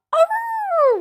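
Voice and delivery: very high, long wail; wolf pups wailing. A narrator's reading. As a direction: wail it like little wolves. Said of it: "wailed the five".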